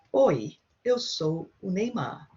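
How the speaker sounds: slow and clear: Oi, eu sou o Neymar; slow model repeat. Shown as a demonstration. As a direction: repeat it slowly for the class. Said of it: "you've seen two greetings here".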